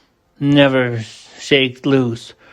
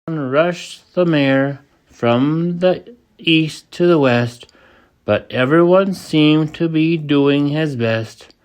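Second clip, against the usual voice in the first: plain reading voice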